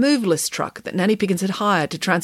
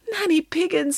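high, mildly mournful address; understated, high-voiced sadness; sad; 10 semitones higher than usual. A narrator's reading. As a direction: say the name in sad dismay. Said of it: "said Derek sadly".

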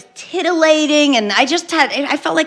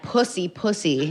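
dead inside; 4 semitones lower, 7 dB quieter than usual. Acted. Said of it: "Now I'm just dead inside".

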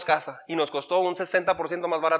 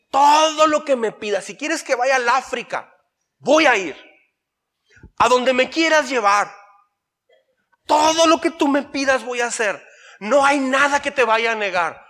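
fervent surrender; 7 semitones higher than usual. Retold or imitated. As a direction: pour out a total surrender to God. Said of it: "Oré así".

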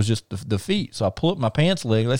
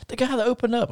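high, put-on wife voice; mock girly explaining; imitating wife; 12 semitones higher, 4 dB quieter than usual.

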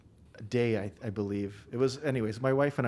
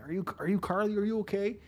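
higher, worried checking-in voice; concerned cops; 9 semitones higher, faster than usual. Retold or imitated. Cops at the door checking on wife, higher concerned. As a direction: ask gently and high like a worried officer. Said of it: "they're like".

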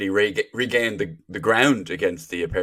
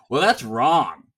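loud, higher mock correction; blunt contradiction; 5 semitones higher, 6 dB louder, much slower than usual. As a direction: flatly contradict them, loud and raised. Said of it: "doesn't go".

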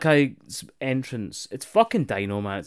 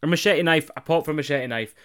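ordinary talk, nothing marked

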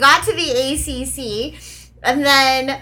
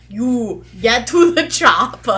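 put-on slow Austrian-accented movie line; drawn-out action hero accent; Schwarzenegger impression; slower than usual. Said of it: "I was gonna say".

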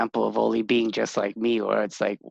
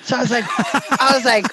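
laughing; 10 semitones higher, faster than usual. Real, not acted. Laughing while telling story.

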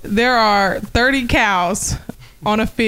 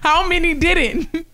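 laughing; 7 semitones higher, much slower than usual. Real, not acted. Gives riddle answer laughing.

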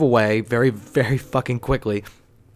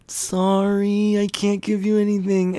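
high, drawn-out sheepish sorry; imitating an uncomfortable, high-pitched refusal; awkward apology; 8 semitones higher, slower than usual. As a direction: refuse apologetically in a much higher voice. Retold awkward refusal to the man.

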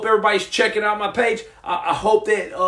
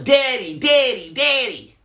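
higher-pitched mock kids' yell; insistent little calls, pitched up; kids yelling; 5 semitones higher than usual. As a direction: imitate kids calling out for their dad. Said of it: "these kids are yelling".

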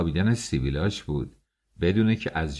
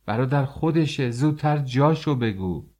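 higher, pressing shout; impatient shout; 6 semitones higher than usual. Acted. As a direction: urge him on, fed up. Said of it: "داد زدم".

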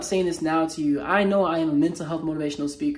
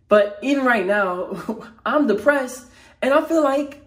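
higher and louder, real frustration building to the edge of a yell; close to yelling; 4 semitones higher, 4 dB louder than usual. Real, not acted. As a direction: let the real frustration push the voice up until it almost breaks into a yell. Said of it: "I was about to just start yelling".

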